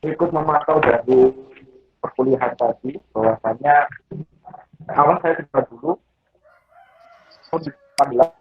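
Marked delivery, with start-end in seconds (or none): none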